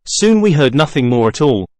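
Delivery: plain reading voice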